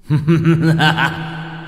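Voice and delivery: thunderous laugh, pitched high; booming laugh. A narrator's reading. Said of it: "giọng oang oang như sấm". (tiếng cười oang oang như sấm)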